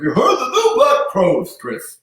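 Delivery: high, loud announcer voice, self-mocking; bad Howard Finkel impression. The announcer chatting about music, hammed-up announcer voice. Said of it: "I'm doing the worst Howard Finkel".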